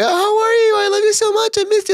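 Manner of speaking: very high, loud, sugary gushing; woman mocked as gushing over a friend, squealing high. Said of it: "a woman will be like".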